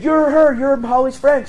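very high, loud re-enacted exclamation; excited recognition. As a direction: pounce on a stranger with eager recognition. Re-enacting eager approach to startled stranger.

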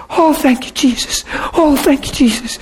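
lightly voiced joyful woman, high and soft on Jesus; mock joyful thanks. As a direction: imitate her high happy thanks.

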